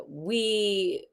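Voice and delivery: put-on French accent. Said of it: "should I use a French accent?".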